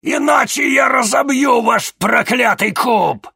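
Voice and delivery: high roaring threat; demon growl. A narrator's reading. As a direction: bellow the threat, furious demon. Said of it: "прорычала Лакабал".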